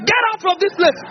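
high-pitched, exasperated reenacted order; retold angry dismissal. Pastor rejecting the begging brother, retold in high exasperation. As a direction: cut off his begging, pitched well up.

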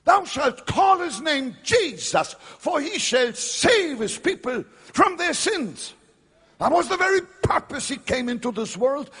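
high, loud scripture proclamation; fervent preaching. Real, not acted. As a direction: preach it with full force.

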